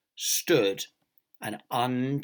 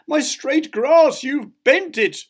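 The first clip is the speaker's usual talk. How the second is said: high, loud, drawn-out complaint; anguished cry; 10 dB louder, slower than usual. A narrator's reading. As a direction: wail it slowly in comic outrage. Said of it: "cried Mister Fussy".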